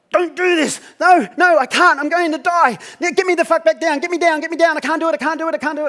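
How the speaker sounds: high, loud terrified pleading; panicked screaming. Retold or imitated. Jan panicking on the steps, screaming high to be let down. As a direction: beg in sheer panic to be let down. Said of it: "Jan collapses in a sobbing, screaming heap".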